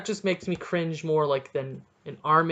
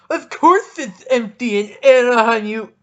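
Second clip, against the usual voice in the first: much higher, loud, sneering angry fan voice; mocking angry fan; 7 semitones higher, 11 dB louder than usual. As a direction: ham up an outraged fan's tweet. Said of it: "I got a really mad tweet saying".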